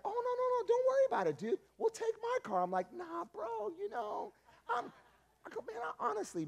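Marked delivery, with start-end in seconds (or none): 0.0-2.6 very high, excited friend's voice; eager roommate. Acted. Roommate insists on driving, high and eager. 2.9-6.5 high, pleading mock whine; whiny excuse. Dodging church with his roommate, high whiny excuse.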